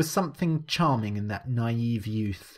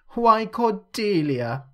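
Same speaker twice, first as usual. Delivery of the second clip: slow, much higher teasing voice, drawn out; almost mocking; 10 semitones higher, 5 dB louder, slower than usual. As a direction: tease her with a sing-song lilt. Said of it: "Alicia said almost mockingly".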